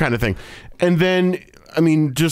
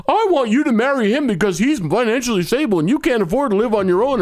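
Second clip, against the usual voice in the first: mocking the mom; 8 semitones higher, faster than usual. Mocking the mom's advice.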